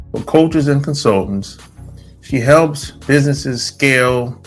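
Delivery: announcer-like intro in spaced-out phrases; host introduction. Acted. Host welcoming listeners and presenting a guest, show intro. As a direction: present the guest with deliberate pauses.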